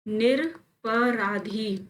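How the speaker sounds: निरपराधी stretched out in syllables; slow wrong form. Shown as a demonstration. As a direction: spell out निरपराधी, nir... pa-raa-dhee. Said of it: "गलत है". (निरपराधी धीरे तोड़कर)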